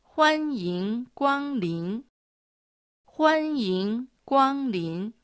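slow, carefully articulated Mandarin model reading of 欢迎光临, each syllable and tone clear; slow model phrase. Shown as a demonstration. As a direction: say 欢迎光临 slowly like a teacher modelling it. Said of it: "お客さんが来たときは".

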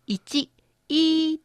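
い drawn out high and even; high level tone. Shown as a demonstration. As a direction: hold い on one high pitch. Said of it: "同じように高く出てく". (「い」を高く伸ばす)

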